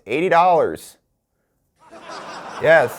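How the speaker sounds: ordinary talk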